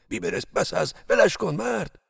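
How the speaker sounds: loud urgent call to stop, rising high; shouting to stop. Acted. Guard yells at Bibars to stop the whipping, loud. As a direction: yell at him to quit.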